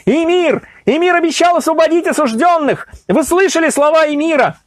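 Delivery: rally them, shout it out. A narrator's reading. Nasreddin being seized, shouting to the people. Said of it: "Он кричал".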